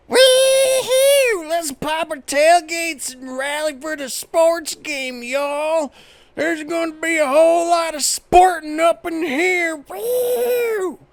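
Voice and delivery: a hollering tailgate party jock; small town jock. Pretend. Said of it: "a small town jock".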